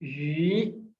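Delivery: यू drawn out with a rise; second tone. Shown as a demonstration. Teacher reading the word for fish, rising tone on यू. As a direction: lift यू slowly like a question. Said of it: "second".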